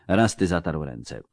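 plain reading voice

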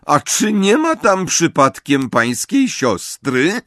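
high, slow, pointed question; prim professor. A narrator's reading. Professor questions Amisius about his sister, coolly pointed. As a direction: ask with cool, mock-polite precision.

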